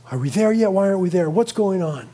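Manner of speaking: ordinary talk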